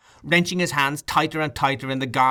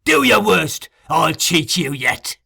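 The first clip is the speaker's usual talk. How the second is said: loud, then slow defiant snarl; a murderer taunting the crowd from the window; 4 semitones higher, 6 dB louder, slower than usual. A narrator's reading. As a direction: taunt the mob below. Sikes taunts the mob.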